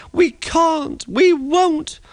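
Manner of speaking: very high whiny truck voices; high frightened whine; panicky trucks. A narrator's reading. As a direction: squeal the refusal. Trucks refusing again.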